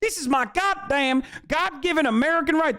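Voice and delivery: high, mocking voice of an outraged patriot; mocking rioter. Streamer imitates a rioter defending himself, high and mocking. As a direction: parody a rioter claiming his rights. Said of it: "You're like".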